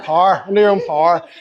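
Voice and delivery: "power" in a broad Northern Irish accent, one syllable, "par". Shown as a demonstration. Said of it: "Northern Irish accent".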